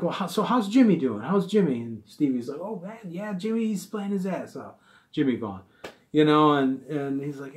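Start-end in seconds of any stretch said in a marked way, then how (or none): 0.0-1.8 higher, eager fan voice; lightly mock the overfamiliar question; nosy groupie. Groupies asking about [name]. 2.6-4.7 higher, soft polite musician voice. Retold or imitated. [name] answering groupies politely.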